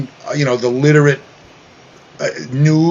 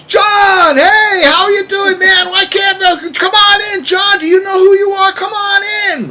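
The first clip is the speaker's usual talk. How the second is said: much higher, loud, hammed-up radio-host shout; mock Howard greeting; 4 dB louder than usual. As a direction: yell a big fake-friendly welcome at John. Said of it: "Howard goes".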